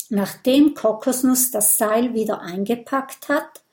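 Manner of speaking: ordinary delivery, nothing marked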